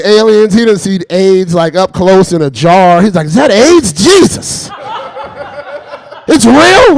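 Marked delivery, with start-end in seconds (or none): 3.3-7.0 high, loud mock astonishment; shocked disbelief. Obama shown AIDS in a jar, hammed-up shock. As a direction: blurt it out hugely aghast, pitched way up.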